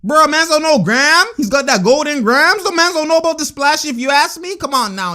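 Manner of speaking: high, worked-up exclaiming; worked-up hype. Real, not acted. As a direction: hype the shooter in exasperated disbelief. Worked up over opponent's shot.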